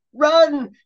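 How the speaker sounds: very high, loud squealed run. Acted. Jeremy squealing at his friend to flee. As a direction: squeal in panic. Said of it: "he squealed".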